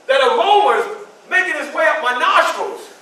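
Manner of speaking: ordinary delivery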